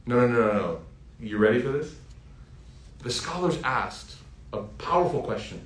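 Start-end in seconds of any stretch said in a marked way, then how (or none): none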